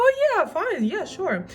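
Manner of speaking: squeaky high eager yes; high-pitched mock eagerness. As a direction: chirp it like a naive girl. Mock eager agreeing to job.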